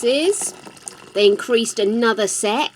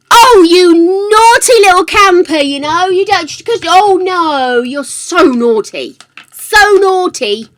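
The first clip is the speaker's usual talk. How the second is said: loud, higher teasing scold; mock scolding; 8 semitones higher, 11 dB louder than usual. Real, not acted. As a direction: scold them playfully, tongue in cheek. Playful scolding of viewer.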